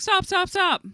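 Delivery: high, loud stop stop stop; high scolding. Retold or imitated. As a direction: scold the dog sharply. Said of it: "and I'm like".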